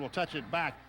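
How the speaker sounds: ordinary talk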